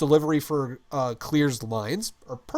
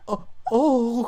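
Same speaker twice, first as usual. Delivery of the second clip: mock gasps; 9 semitones higher, 4 dB louder than usual. Mimicking cohost's reaction.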